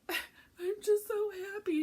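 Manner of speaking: high, drawn-out tearful whine; mock bawling. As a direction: wail it slowly like a crying child. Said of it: "broken down and bawling like a child".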